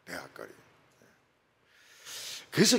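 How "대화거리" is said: usual speaking voice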